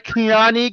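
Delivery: "Kehlani" said slowly as kuh-LAH-nee, stress on the middle syllable; careful Kehlani. Shown as a demonstration. Host carefully re-saying the wrestler's name after a correction. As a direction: sound out "Kehlani" with care, lightly checking it's right. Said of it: "Did I say it right?".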